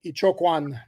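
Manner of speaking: Ichokwan said as ee-CHOKE-wahn; correct pronunciation. Shown as a demonstration. Cohost asks how to say the name, so he says Ichokwan. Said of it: "How, how did you say his last name?".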